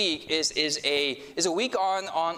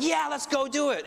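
much higher, keen rallying call; fired up to go out; outgoing folks rallying for evangelism, eager; 10 semitones higher than usual. Retold or imitated. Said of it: "They go and they're like".

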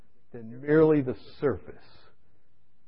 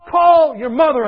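slow, loud, high booming command; voice from above; 12 semitones higher, 10 dB louder, slower than usual. Retold or imitated. A mystic voice ordering him to call his mother, slow and booming. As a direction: call out like a voice from heaven. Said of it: "this voice came to me".

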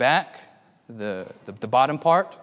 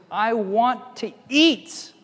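high, slow whine; drawn-out childish demand; upset little child; 8 semitones higher, much slower than usual. Retold or imitated. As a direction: whine like an upset small boy. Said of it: "The little child was very upset".

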